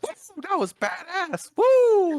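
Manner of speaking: high-pitched hyped whooping, imitating a thrilled friend; excited yelling. Friend finds his car isn't ruined, excited yelling. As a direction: imitate a pal yelling with wild relief, voice shooting way up. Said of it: "he started yelling".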